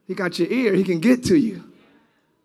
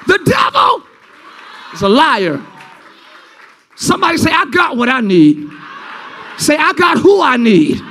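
loud, high, hammered-out preaching; shouting sermon climax; full-force preaching; 8 semitones higher, 6 dB louder than usual. Acted. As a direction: preach it hard over the music.

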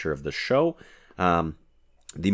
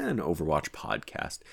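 ordinary talk, nothing marked

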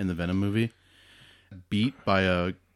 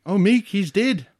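slow, higher-pitched movie-character quote; drawn-out, raised mock line; Korg impression; 9 semitones higher, 5 dB louder, slower than usual. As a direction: lightly mimic Korg breaking the bad news. Acting out Korg's line.

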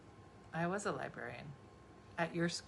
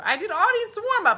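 excited; 8 dB louder than usual. Real, not acted. Excited boast.

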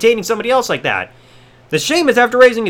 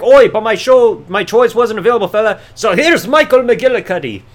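mock Sheamus Irish accent. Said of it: "He's like".

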